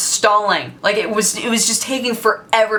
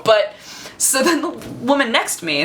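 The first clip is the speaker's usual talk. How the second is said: usual speaking voice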